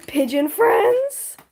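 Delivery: high, slow and loud gasping question; excited surprise. Real, not acted. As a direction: stretch it out in delight. Gasps, then repeats question.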